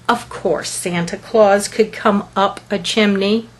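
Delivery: ordinary delivery, nothing marked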